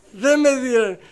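upset denial. Retold or imitated. Upset husband retold to wife.

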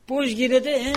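loud, high rhetorical question; rhetorical preaching. Real, not acted. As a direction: challenge the congregation.

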